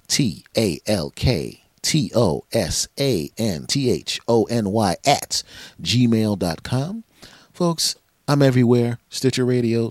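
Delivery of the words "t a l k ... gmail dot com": slow, letter by letter; spelled out. Shown as a demonstration. For listeners who email, spelling slowly. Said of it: "talk to S Anthony at gmail".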